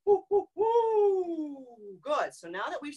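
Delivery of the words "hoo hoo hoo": high, slow hooting hoo; owl hoot. Shown as a demonstration. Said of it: "Once more".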